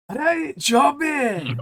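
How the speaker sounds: drawn-out, high Scottish "Hey, Johnny!"; Scottish accent. Shown as a demonstration. Showing friends how to get into a Scottish accent, Scottish accent. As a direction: stretch it out in a put-on Scottish accent. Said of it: "to get into a Scottish accent is".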